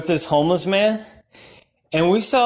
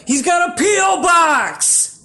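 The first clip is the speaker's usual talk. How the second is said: shouted punchline; 10 semitones higher than usual. Acted. Comic explaining his homeless pen pal, shouted reveal. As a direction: yell the punchline.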